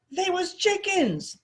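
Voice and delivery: high, slow bellow after him. A narrator's reading. Foreman bellowing after the fleeing boy. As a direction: bellow it down the street. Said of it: "the bald guy bellowed after him".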